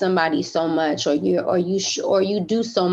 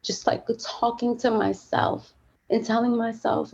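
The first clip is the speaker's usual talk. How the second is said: choked up; 3 semitones higher than usual. Real, not acted. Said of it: "getting choked up talking about this".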